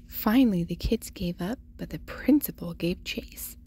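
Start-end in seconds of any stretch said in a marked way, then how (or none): none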